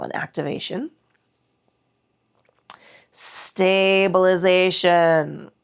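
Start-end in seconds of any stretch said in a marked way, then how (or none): 3.1-5.5 slow drawn-out cue. Shown as a demonstration. Instructor cueing the class, drawn-out and slow. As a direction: stretch out "Stabilization" slowly and evenly. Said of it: "says the cue word twice, differently".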